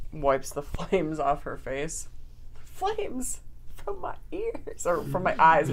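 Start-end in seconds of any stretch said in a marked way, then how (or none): 2.5-4.8 squeaky mock line. Misquoting Murder She Wrote line.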